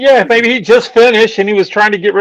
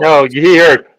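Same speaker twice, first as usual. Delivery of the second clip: ordinary talk, nothing marked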